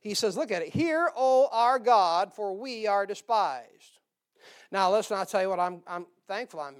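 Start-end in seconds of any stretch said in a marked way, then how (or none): 0.7-4.0 loud, slow cry to God; praying aloud. Acted. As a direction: proclaim the prayer slowly at full voice. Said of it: "he starts praying in verse four".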